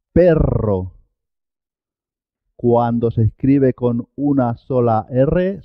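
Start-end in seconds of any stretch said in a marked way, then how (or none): 0.1-0.9 perro with a long rolled rr; double R. Shown as a demonstration. Teacher confirms perro sounds double, rr rolled. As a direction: roll the R in perro firmly. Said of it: "suena doble".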